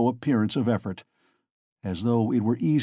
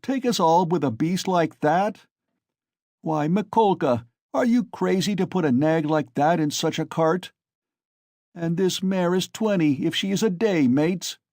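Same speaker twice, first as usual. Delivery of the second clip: a hint of mocking laughter; raised, scornful heckling; jeering crowd voice; 6 semitones higher than usual. A narrator's reading. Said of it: "outbreak of laughter and exclamations".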